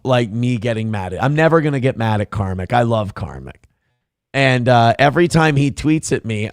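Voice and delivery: usual speaking voice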